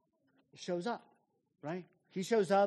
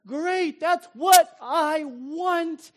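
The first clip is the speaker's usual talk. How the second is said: higher and louder, tossing it off gladly; so what, great, higher and louder; carefree and elated; 8 semitones higher, 9 dB louder than usual. Acted. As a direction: shrug it off with delight. Voicing John's carefree reply.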